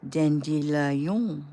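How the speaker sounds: slow, drawn-out French pronunciation of Dandelion; heavy French accent, unhurried; thick French accent. A narrator's reading. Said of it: "saying in a thick French accent".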